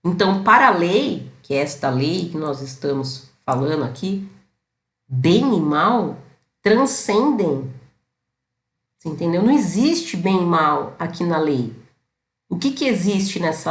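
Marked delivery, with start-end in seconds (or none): 5.1-7.7 very slow, each word weighed: "bem e mal transcendem"; slow emphasis. Real, not acted. Teacher explaining the law to viewers, slow and weighty. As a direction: spell out the key idea deliberately.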